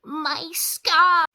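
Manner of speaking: a high, loud mock cry of pain over a hurting scar; hammed-up Harry. Vlogger hamming up Harry's scar pain for viewers, in a high, loud mock cry. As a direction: overact a boy wizard clutching his scar. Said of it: "Harry never sounded like that".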